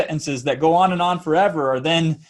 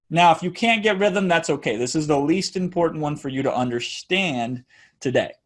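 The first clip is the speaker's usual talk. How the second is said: usual speaking voice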